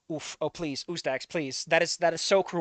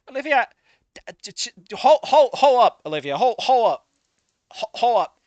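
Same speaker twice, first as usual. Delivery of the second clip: louder stuttering hold up; tripping over the words in disbelief, louder; shocked stammer; 6 semitones higher, 8 dB louder than usual. Real, not acted.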